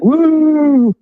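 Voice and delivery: cheering. Real, not acted. Cheering a toast on stream.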